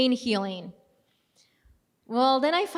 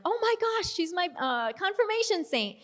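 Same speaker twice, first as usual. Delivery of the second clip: high-pitched gasp of delighted surprise; excited squeal; 7 semitones higher than usual. Retold or imitated. Telling a priest they share a saint, high excited squeal. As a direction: squeal it like a fangirl meeting her idol.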